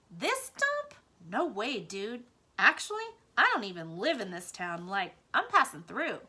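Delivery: a breezy surfer-mouse brush-off; cool mouse. A narrator's reading. Ragweed scoffing at the dump, loud breezy dude.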